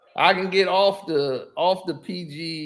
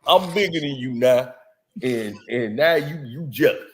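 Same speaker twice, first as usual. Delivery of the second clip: lightly mimicked low, marble-mouthed boast; a touch of mush-mouthed mimicry, pitched down; Shannon Sharpe; 4 semitones lower than usual. Mimicking a sports host taunting a jealous rival, lightly marble-mouthed boast. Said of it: "I can get those voices".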